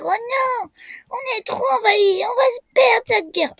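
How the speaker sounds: high-pitched mock wail of despair; panicked inhabitants. Player voices his invaded little citizens, high mock panic. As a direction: voice the little townsfolk wailing, high and hammed-up. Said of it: "mes petits habitants, ils vont se dire".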